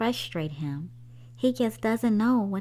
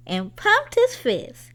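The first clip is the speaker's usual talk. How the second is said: higher, louder, slower burst; excited reading; 10 semitones higher, 5 dB louder than usual. A narrator's reading. Narrator reads Henry's big moment with excitement. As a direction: land the triumph with a lift.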